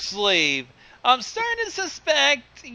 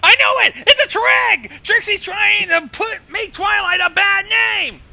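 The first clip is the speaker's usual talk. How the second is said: excited; 7 semitones higher than usual. Real, not acted. Listener cries conspiracy.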